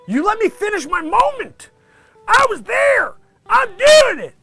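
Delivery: high, loud outraged yelling; angry rant. Acted. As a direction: blow up at the director. Ranting at the director.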